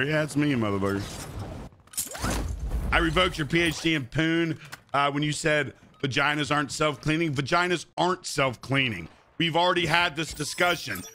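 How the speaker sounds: ordinary talk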